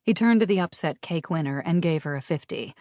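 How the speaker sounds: ordinary delivery, nothing marked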